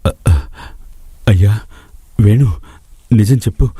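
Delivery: panting, stammering after running; father rushing home breathless, calling his son; out of breath. Acted. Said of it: "పరుగు పరుగున ఇంటికి వచ్చాడు".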